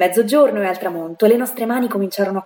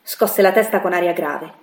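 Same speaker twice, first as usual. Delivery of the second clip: plain reading voice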